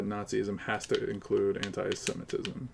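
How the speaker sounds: ordinary talk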